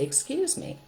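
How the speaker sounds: lifted, sing-song "Excuse me"; bright polite apology; high polite "excuse me". Shown as a demonstration.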